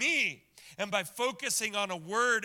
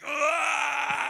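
loud pained cry, pitched up; groan of pain; 8 semitones higher, 7 dB louder than usual. Retold or imitated. Believer hit by a hammer, pained cry. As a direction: yelp as the hammer hits your foot. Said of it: "all you get are groans coming out".

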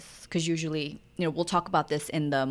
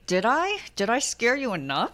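mock retort; 5 semitones higher than usual. Mother's mock retort to complaint.

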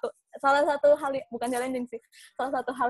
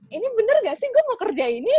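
higher-pitched retold self-doubt; mock tearful doubt; 11 semitones higher, 4 dB louder, faster than usual. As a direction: wail the question in mock despair. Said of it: "aku sempet sampai nangis".

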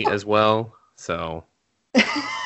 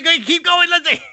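very high, loud flustered push; flustered squeak; 5 dB louder than usual. Real, not acted. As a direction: hurry her on, embarrassed. Covering an embarrassing realization.

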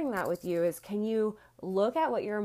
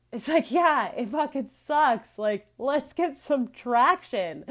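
louder and higher, fired up, the last line slowed; fired-up emphatic; 6 semitones higher, 5 dB louder than usual. Real, not acted. Host rallying listeners to get traction, fired up and loud. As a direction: punch it out with real energy.

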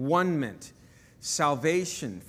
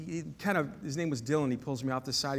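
normal talking voice

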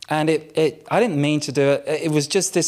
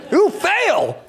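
high, louder teasing "Ooh, fail"; mock game-show fail; 13 semitones higher, 4 dB louder than usual. Pastor mocking his own failed test, playful high jeer. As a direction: sing out "Ooh, fail" like a buzzer judge.

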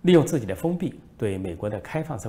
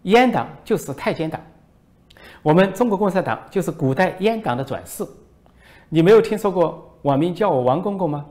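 mock lecture; 8 semitones higher, 8 dB louder than usual. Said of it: "王毅说".

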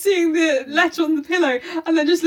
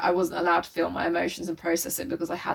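quiet resentful; 8 semitones lower, 8 dB quieter than usual. Retold or imitated. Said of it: "if he was like".

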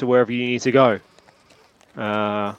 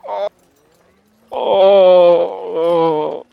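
groaning in pain; 5 semitones higher, 6 dB louder, much slower than usual. Acted. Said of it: "He's just like".

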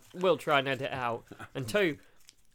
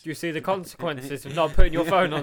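loud, high, laughing boast; laughing tease; 3 semitones higher, 12 dB louder than usual. Real, not acted. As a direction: crow it through laughter. Teasing co-host, laughing loudly.

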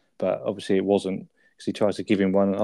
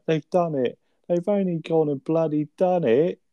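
higher, slow triumphant Del; excited announcement; 7 semitones higher, slower than usual. Retold or imitated. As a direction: proudly drag out the good news.

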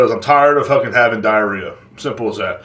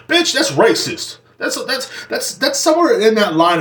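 mock outrage; 10 semitones higher, slower than usual. Mock outrage over dog.

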